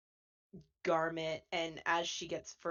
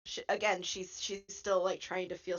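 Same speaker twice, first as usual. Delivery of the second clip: usual speaking voice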